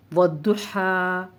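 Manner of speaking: slow recitation, final aa of वद्दुहा held; unchanged long vowel. Shown as a demonstration. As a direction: recite वद्दुहा slowly, stretching the ending. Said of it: "ऐसे ही पढ़ा जाएगा". (वद्दुहा, खड़ी ज़बर को खींचकर)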